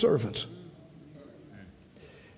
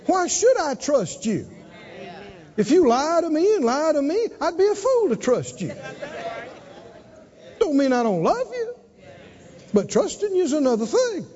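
higher, firm father voice; higher, firm parent refusing trust; 6 semitones higher than usual. Retold or imitated. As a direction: lay down the law but lovingly. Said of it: "He said".

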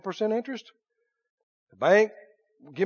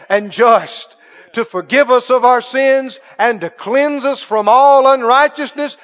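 slow, rising preacher's build to a louder close; preaching; 4 semitones higher, 9 dB louder than usual. Acted. Preacher proclaiming God's forgiveness to the congregation, building. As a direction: proclaim it to the church.

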